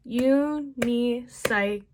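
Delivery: slow, broken into syllables; u-ni-cy-cle spoken slowly, syllable by syllable; syllable demo. Shown as a demonstration. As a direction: sound out each beat of the word. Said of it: "I do".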